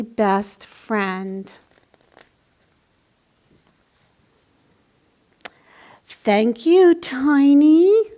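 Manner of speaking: tender read-aloud ending; reading to children. A narrator's reading. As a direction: wind the story down sweetly for kids. Reads a picture book aloud.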